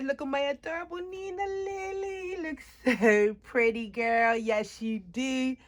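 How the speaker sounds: high, drawn-out adoring coo. Real, not acted. Mother showing off her adorable Nina Lily, real baby talk.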